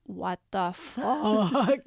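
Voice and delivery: very slow, drawn-out disbelief; mock stunned. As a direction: stretch each word as if appalled. Acting out stunned rich guests' reaction.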